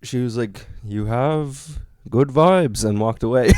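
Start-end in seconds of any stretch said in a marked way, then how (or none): 0.8-2.8 imitated mystic, drawn out; slow pronouncement. Strange woman to flyerer, slow and deliberate. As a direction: deliver the verdict very slowly. Odd woman's verdict, retold.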